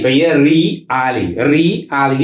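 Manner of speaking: री and आली said apart; री ... आली, split slowly; syllable split. Shown as a demonstration. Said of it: "यहाँ पर है रियली". (री आली अलग-अलग बोलें)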